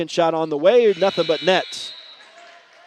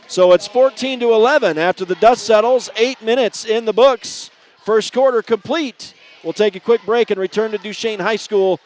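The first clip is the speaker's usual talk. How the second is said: projected, higher-pitched sportscast wrap-up; announcer's break sign-off; 3 semitones higher, 3 dB louder than usual. Real, not acted. Announcer closing the first quarter, upbeat radio sign-off. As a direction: sum up the quarter and throw to break.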